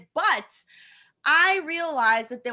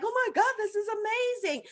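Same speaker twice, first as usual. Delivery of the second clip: gushing; 9 semitones higher than usual. Retold or imitated. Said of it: "And I would say to them".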